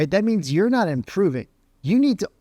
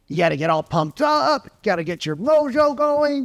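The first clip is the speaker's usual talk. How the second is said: high-pitched, hyped-up pep talk; mock hype, voice pushed up high; pumped up; 8 semitones higher than usual. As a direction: psych yourself up like an overeager salesperson. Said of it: "how the average salesperson makes a phone call".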